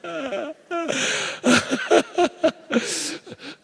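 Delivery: a burst of high cracking laughter; high shrieking laugh. Real, not acted. Laughs at own joke.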